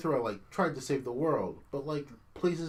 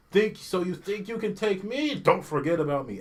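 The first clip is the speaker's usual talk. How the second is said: higher and louder, a cocky enemy taunt; hammy enemy bark on getting hit; mock game battle taunt; 7 semitones higher, 6 dB louder than usual. Gamer mocking the game's taunt, hammy enemy voice. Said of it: "the stupid lines that repeat every time you hit me".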